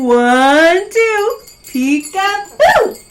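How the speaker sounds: high, loud playful magic chant; kids party magician. Pretend. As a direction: cast the spell big for toddlers. Saying the magic words together.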